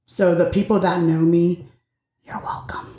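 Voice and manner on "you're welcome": unvoiced whisper, much softer; whispered aside. Real, not acted. Telling those who know her they're welcome, whispered. Joking aside, then laughs.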